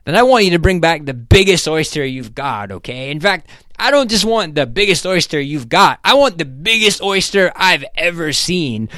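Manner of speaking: high, loud, emphatic demands; worked-up boss laying down orders; irritated. A narrator's reading.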